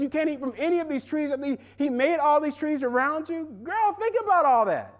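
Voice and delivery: high, mocking serpent, quick on God's rule; the serpent egging Eve on, high and mocking. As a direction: scoff at the rule, then egg Eve on. Serpent mocks God's command to Eve.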